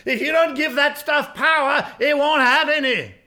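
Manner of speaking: forceful preaching. Acted. Preacher warns congregation about the devil, forceful preaching. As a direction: preach it hard, pushing the key words.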